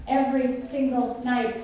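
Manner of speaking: EVERY SINGLE NIGHT drawn out word by word; slow right down on "Every single night"; weighty, deliberate repeat of "Every single night"; slow emphasis. Real, not acted. Repeats phrase slowly for weight.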